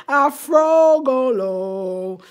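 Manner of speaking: loud, hugely stretched-out showy call; hammed-up flourish. Showing off her African bungalow nickname, playfully over the top. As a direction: belt it out slowly, milking every syllable. Hams up her coined word.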